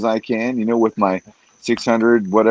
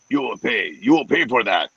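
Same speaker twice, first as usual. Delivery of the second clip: normal talking voice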